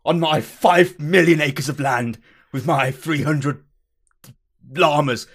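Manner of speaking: loud, hammed-up Victorian English boasting; indignant ye olde English toff, slowing grandly; Victorian English gentleman. Customer boasting of his mansion and land, loud Victorian English gentleman. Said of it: "a ye olde English Victorian-y style".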